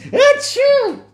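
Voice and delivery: high sudden sneeze. Acted. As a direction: burst out sneezing. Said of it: "he sneezed".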